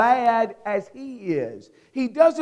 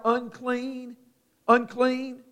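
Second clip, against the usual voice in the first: ordinary talk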